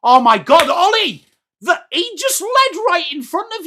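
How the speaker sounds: high, loud disbelieving cry; exasperated outburst. Real, not acted. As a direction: blurt it out in shock at the mistake. Exasperated call at a blunder.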